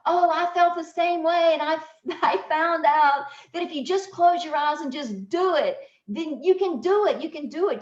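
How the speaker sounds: high, loud imitation of eager cheerleaders; encouraging. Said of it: "I hear all these people, you know, encouraging me".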